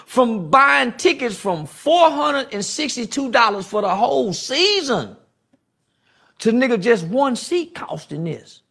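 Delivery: high, slowed preaching; high fired-up sermon pitch; impassioned. Real, not acted. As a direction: raise it up and lean in. Impassioned rant.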